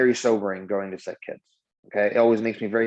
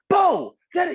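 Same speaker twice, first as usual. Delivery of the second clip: high, loud parental shout; raised voice; 5 dB louder than usual. Acted. Dad shouting at his son in the street, raised voice. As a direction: shout sharply to stop a child running off. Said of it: "need me to raise my voice".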